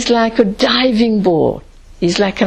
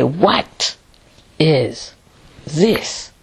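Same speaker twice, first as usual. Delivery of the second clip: WHAT set apart, then a pause before each word; stress on WHAT; 4 semitones lower, much slower than usual. Shown as a demonstration. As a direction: lean on "What", leaving gaps between the words. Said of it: "put the emphasis and say".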